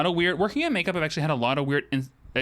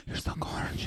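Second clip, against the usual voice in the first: soft unvoiced whisper right behind; creepy whisper; 8 dB quieter than usual. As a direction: breathe it close behind her back. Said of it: "And she'd be like".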